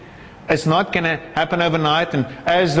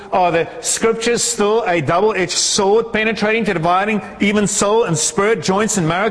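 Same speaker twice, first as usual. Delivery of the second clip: higher-pitched, fervent sermon climax; impassioned preaching; 6 semitones higher than usual. Acted. Preacher calling the church onto the mission, impassioned. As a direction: drive the rhetorical questions up to an emphatic answer.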